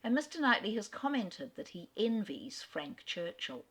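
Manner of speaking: ordinary delivery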